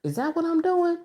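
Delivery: high, soft stunned question; startled self-question. Retold or imitated. Realizing in a past relationship, high and hushed. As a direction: catch yourself in a realization. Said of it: "I was like".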